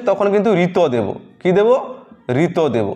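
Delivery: ordinary talk, nothing marked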